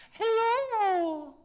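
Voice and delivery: very high, loud head voice; high ringing hello. Shown as a demonstration. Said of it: "Now we're gonna find our head voice".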